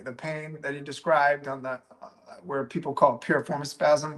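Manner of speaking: usual speaking voice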